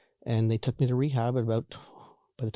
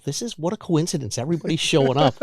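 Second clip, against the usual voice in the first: higher, naive surprise breaking into laughter; mock amazement; 5 semitones higher, 4 dB louder than usual. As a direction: marvel at the coincidence, tongue in cheek. Mocks his own naive thought, laughing.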